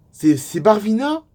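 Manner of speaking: slow, stammered, rising high and loud; stunned incredulity. Acted. Fan realising who the fiancée is, stunned incredulity. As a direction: ask as if unable to believe it. Acted disbelief at a famous name.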